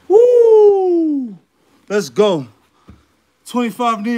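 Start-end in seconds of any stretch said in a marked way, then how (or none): 0.1-1.4 high, loud whoop; celebratory whoop. Real, not acted. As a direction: whoop in triumph. Finishing set celebration.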